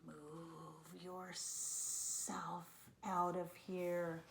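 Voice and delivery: slow, drawn-out snake voice. A narrator's reading. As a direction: stretch it out like a menacing snake. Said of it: "says a snake".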